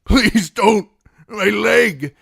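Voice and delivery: high, loud pleading in pain; pained begging. A narrator's reading. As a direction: cry out over the shattered leg. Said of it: "Dick whimpered".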